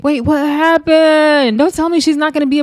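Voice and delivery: stunned, dragging out the question; shocked. Real, not acted. Shocked reaction to blurred face.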